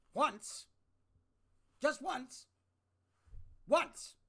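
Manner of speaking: escalating yell of one word; building shout. Real, not acted. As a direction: build from firm to yelling. Escalating repeat of demand.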